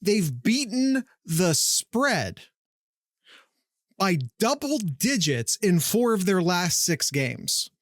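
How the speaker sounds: slow, weighty delivery; slow emphatic. Real, not acted. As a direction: lean on each word slowly.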